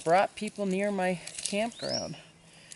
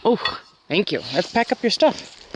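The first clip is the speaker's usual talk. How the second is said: high, loud, bright pet talk; coaxing the dog; 5 semitones higher, 9 dB louder than usual. Real, not acted. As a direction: call the dog over, cheering it on to bring the bowl. Coaxing her dog to pack.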